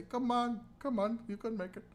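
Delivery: high, sing-song mock Indian accent. Imitating pedestrians urging a partner across, mock Indian accent. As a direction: coax in a hammed-up Indian accent. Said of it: "and they say".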